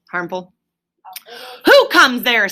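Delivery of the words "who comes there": loud, sharp sentry challenge; loud sharp ferret challenge. Acted. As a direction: bark it out like a guard. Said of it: "said the ferret sharply".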